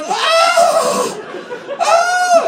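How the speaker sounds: very high, loud ecstatic cries; hammed-up ecstatic yells; loud moans. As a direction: shout it like she's in ecstasy. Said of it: "she's going".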